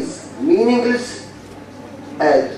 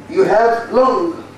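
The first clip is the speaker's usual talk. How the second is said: long drawn out, higher; slow stressed long; 5 semitones higher, much slower than usual. Shown as a demonstration. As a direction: slowly spell out long for students. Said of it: "Long is meaningful".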